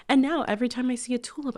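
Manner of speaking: normal talking voice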